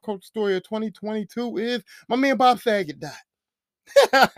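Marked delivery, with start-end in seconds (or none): 3.0-4.4 laughing. Real, not acted. Laughs after joke.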